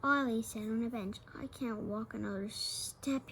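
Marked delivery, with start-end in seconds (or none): 1.3-3.2 tired whisper. Acted. Ollie on the bench, whispering wearily. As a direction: breathe it out, too tired to go on. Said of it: "he said".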